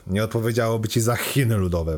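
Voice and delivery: ordinary delivery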